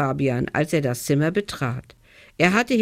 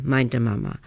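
usual speaking voice

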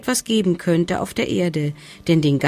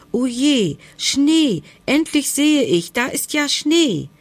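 higher and louder, delighted; joyful discovery; 8 semitones higher, 4 dB louder than usual. Acted. Hedgehog thrilled he finally sees snow, higher and louder. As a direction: burst out in excitement.

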